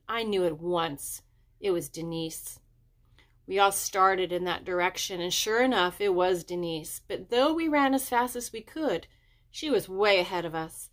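usual speaking voice

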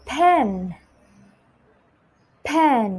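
"pen" as a careful, separate word; slow, clear model. Shown as a demonstration. Teacher models "pen" for children to repeat, slow and clear. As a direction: pronounce "pen" distinctly with a falling tune. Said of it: "อ่านตามพี่ช้าๆช้าๆชัดๆ".

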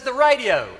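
high, loud cheeky request; cheeky ask. Retold or imitated. Willie begs Father G for the car radio, high and loud. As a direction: try your luck for the radio.

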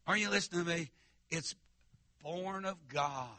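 ordinary talk, nothing marked

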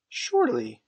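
high, loud, incredulous exclamation; doubting memory. A narrator's reading. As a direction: blurt it in bewildered disbelief. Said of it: "like one who had lost faith in his memory".

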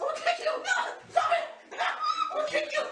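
laughing while tickled; squealing protest through laughter; being tickled. Real, not acted. Friends tickling him, he protests.

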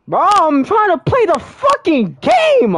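high, loud, slow angry yell; furious shout. Real, not acted. Gamer raging at the game, slow high furious shout. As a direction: drag out every word in rage.

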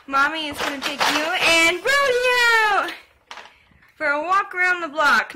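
loud, lilting mommy voice. Real, not acted. Mom promising baby a walk, excited baby talk. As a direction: build excitement for a little one.

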